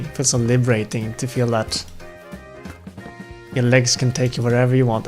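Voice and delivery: ordinary talk, nothing marked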